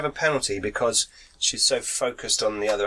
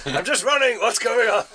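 higher, louder mock character voice; mock panicked character; 11 semitones higher, 4 dB louder than usual. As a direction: play a bewildered runner, hammed up. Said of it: "Morgan just said".

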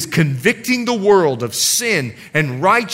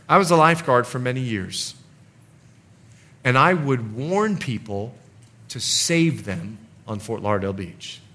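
ordinary delivery

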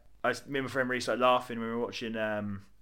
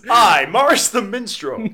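higher, slow grand self-introduction; pompous minstrel; 10 semitones higher, 14 dB louder, slower than usual. Acted. Morris the minstrel elf grandly introducing himself. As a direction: announce yourself like a proud bard.

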